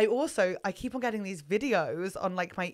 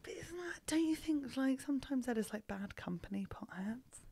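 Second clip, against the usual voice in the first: whispering; 4 semitones higher, 12 dB quieter than usual. Real, not acted. Hushed aside about potheads.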